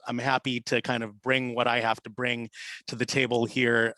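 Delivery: usual speaking voice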